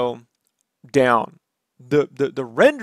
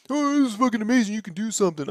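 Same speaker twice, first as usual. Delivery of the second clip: quick, higher, gushing imitation of a proud mom; 4 semitones higher, 7 dB quieter, much faster than usual. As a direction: overdo the mom's excitement, fast and bright. Mom praises rolled R's.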